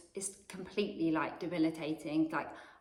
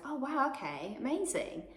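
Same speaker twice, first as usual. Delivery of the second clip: higher, stunned oh wow; 6 semitones higher, 3 dB quieter than usual. Retold or imitated. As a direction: sound floored and a bit unsure. Stunned by psychic prediction.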